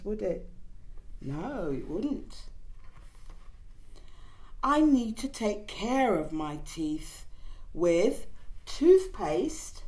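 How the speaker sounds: ordinary delivery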